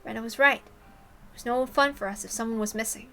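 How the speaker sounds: usual speaking voice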